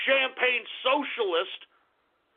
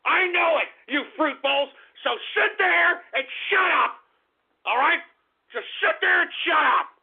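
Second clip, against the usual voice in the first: high, loud angry yelling; furious shout; 6 semitones higher, 3 dB louder than usual. Real, not acted. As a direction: shout them down, full of rage. Ranting at internet trolls.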